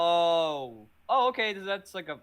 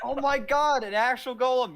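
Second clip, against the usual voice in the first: loud, high, hammed-up fear of a monster; mock fright; 7 semitones higher, 6 dB louder than usual. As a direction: fake a scream at the golem. Mock terror at the golem.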